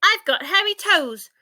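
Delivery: loud, high cheerful shout. A narrator's reading. Ike shouting to the giraffe from a branch. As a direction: belt it out like a proud little monkey. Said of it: "shouted Ike".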